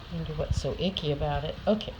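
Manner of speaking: plain reading voice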